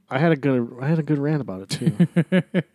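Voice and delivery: chuckling through the words; laughing. Real, not acted. Laughing at own rant.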